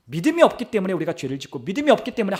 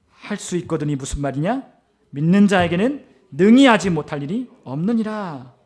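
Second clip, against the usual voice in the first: slow, building to a loud proclamation; preacher voicing Jesus' firm answer; scripture declaration; 7 dB louder than usual. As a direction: declaim the verse with mounting force.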